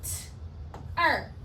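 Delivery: "T-er" softly, as a bare t, a long gap, then er; segmented sounds. Shown as a demonstration. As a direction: separate "T-er" into two sounds. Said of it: "Two phonemes".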